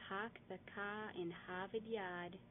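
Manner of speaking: "Park the car in Harvard Yard" slow with no R sounds, "Pahk the cah"; mock Boston accent, R dropped. Shown as a demonstration. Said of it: "is wrong".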